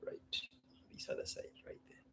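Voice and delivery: unvoiced whisper to himself. Real, not acted. Muttering to himself while setting up, whispered. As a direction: whisper it while fiddling with the camera.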